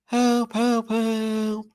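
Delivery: very high mock cry for help; Michael Angelis. Imitating a second narrator's scream, very high sustained cry. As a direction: squeal the helps on one high note. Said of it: "Michael Angelis".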